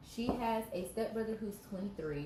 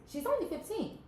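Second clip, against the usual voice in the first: outraged; 14 semitones higher, 3 dB louder than usual. Real, not acted. Outraged emphasis in story reaction.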